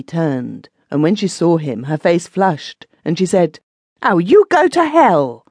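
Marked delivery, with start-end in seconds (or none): 4.0-5.4 much higher, louder outburst; angry retort. A narrator's reading. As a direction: fling it at him and run. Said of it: "unladylike expression".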